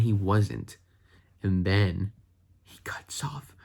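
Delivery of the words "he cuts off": whispering. Real, not acted. Awed recap while watching scene.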